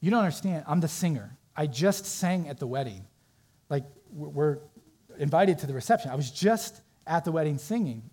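plead, rattled and insistent; retold flustered singer. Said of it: "she gets a little flustered and says".

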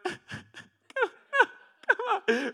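high, strained come on through a laugh; straining for it and laughing at yourself; laughing frustration. Retold or imitated. Leaning over, can't reach it.

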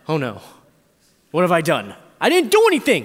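rising panic, pitch climbing high and loud; replaying the moment the engine blew, panicking; panicked. Panicked teen after wrecking dad's car, loud and rising.

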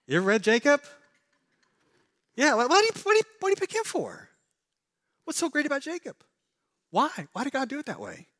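high, incredulous questioning of God's pick; incredulous rising questions. Acted. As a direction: pitch up, baffled. Preacher mocks God's choice of Jacob.

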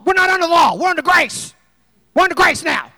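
hammed-up huffy protest; mock protest shout. Grumpy member's protest.

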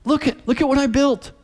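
put-on calling Daddy over, thrilled child; excited little boy. Four-year-old calling his dad to see his garage, high and eager. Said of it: "He goes".

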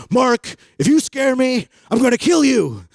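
high, loud mock-angry threat; angry friend. Friend Dan yelling at Mark after being scared, high mock anger. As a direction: play the scared, furious kid. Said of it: "it would just make him angry".

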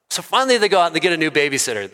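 ordinary delivery

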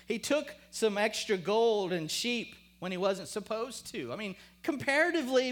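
loud, high, challenging questions; forceful preaching. Real, not acted. Preacher questioning Peter's different treatment, forceful and challenging. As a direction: press the injustice on the congregation.

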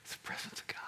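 hushed, breathless words; reverent whisper. Real, not acted. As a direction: whisper it in awe. Reverent answer.

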